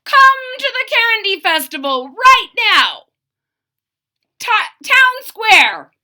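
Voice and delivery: high, loud, carnival-barker call; shouted announcement. Acted. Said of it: "The sign said".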